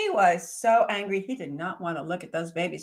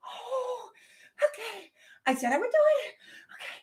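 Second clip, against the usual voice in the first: high little hare voice, nervous; a small high-pitched hare talking herself into it; Snowshoe Hare voice; faster than usual. Acted. Small hare gathering courage, high voice.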